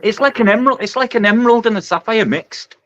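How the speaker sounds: high, thrilled admiration; excited awe. Real, not acted. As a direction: marvel at the colours, pitched well up. Admiring a stunning fish.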